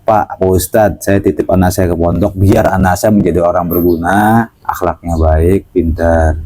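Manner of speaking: lightly voiced humble father pleading politely; humble parent. Parent entrusts child to cleric.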